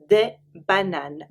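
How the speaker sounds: "des bananes" stretched out slowly for learners; slow repeat. Shown as a demonstration. Teacher slowly repeating "des bananes" for learners. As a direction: drag out "des bananes" so they catch each sound.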